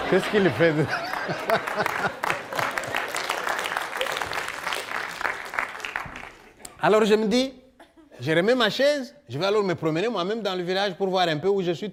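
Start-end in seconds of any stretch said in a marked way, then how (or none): 0.8-6.3 long airy laugh; a long, breathless giggle; breathy laugh. Acted. Imitating curious neighbours, airy laugh.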